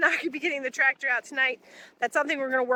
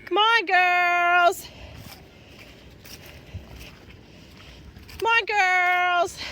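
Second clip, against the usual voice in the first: high, slow, drawn-out sing-song call; calling the cows; 6 semitones higher, much slower than usual. Real, not acted. Farmer calling her cows across the field, long high call. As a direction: stretch the call out to the herd.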